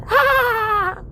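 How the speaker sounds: high loud mock laughter; class laughing. Third graders laughing at the teacher's joke, high and loud. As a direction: laugh along as the whole class.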